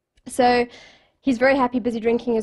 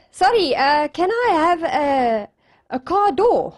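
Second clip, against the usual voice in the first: raised voice, halting polite request; hesitant request; 4 semitones higher, 4 dB louder than usual. Retold or imitated. Man asking the genie for his wish, halting and higher. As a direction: ask a genie for something odd, higher and louder. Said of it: "So he goes".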